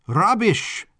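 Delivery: high exclamation from a flamboyant Baron; exclaimed dismissal. Acted. Baron dismissing the assistant's modest introduction, high exclamation. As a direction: exclaim it, pitch jumping up. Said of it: "exclaimed the Baron".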